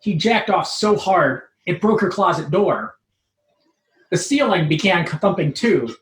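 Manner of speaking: usual speaking voice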